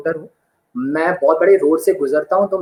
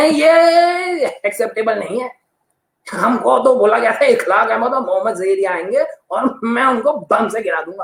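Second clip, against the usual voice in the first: put-on indignant old actor; 4 semitones higher than usual.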